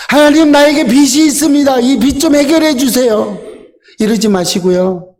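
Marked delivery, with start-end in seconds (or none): 0.1-3.4 higher, imitated begging to God; raised-pitch mock plea; pleading prayer. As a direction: act out a needy appeal for help. Acted wrong prayer begging debt relief.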